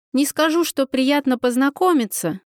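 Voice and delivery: ordinary delivery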